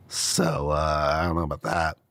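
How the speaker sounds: low, dry, deadpan put-down; sarcastic dismissal. Real, not acted. Lawyer mocking the brief's irony claim, low and dry.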